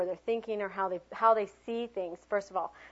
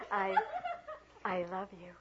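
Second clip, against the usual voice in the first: passionate; 8 dB quieter than usual. Shown as a demonstration. Teacher showing the class a passionate take, soft and tender. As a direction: breathe out a tender confession of love.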